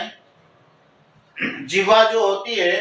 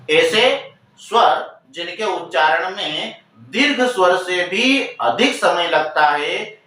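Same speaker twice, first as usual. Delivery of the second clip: slower, stressing each word; slow, deliberate repeat; slower than usual. Shown as a demonstration. Teacher slowly repeating the definition for students. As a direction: spell it out again slowly so students catch it. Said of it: "teacher repeats the definition slower".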